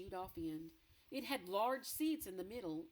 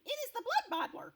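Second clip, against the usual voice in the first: much higher, louder alarmed cry on Blood; scared warning cry; frightened cry; 7 dB louder than usual. Acted. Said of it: "cried the B F G".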